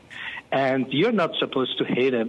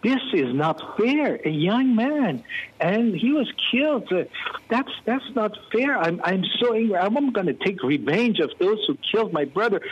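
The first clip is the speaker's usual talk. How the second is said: mock-heated protest, pitched up; retold anger; 7 semitones higher than usual. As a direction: relive the rage at the injustice. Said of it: "it turned to anger".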